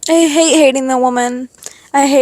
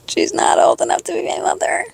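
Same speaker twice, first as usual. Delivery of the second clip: whispered; 5 semitones higher than usual. Real, not acted. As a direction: repeat it in a hushed whisper. Repeated as whisper.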